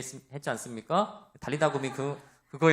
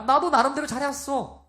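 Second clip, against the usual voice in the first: higher, louder mock indignation; indignant protest; 9 semitones higher, 4 dB louder than usual. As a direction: protest like a hurt pupil. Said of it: "이렇게 하는 경우가". (기분 나쁜 회원이 발끈하는 흉내)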